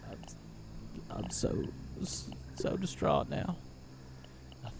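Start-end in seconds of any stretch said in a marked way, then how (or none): 0.1-3.6 whispered, hammed-up despair; mock distraught whisper. Player mourning lost resources in a mock whisper. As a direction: breathe it out in pretend grief.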